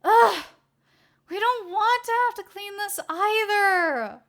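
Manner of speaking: high, loud whiny complaint; a high-voiced young woman groaning in protest; young woman groaning about cleanup; complaining assistant.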